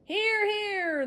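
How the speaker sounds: high, loud cheering agreement; crowd cheer. Acted. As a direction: shout approval together. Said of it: "the monsters cried".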